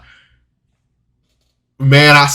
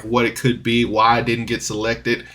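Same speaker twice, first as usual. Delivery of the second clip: ordinary talk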